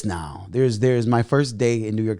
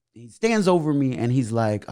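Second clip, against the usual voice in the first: usual speaking voice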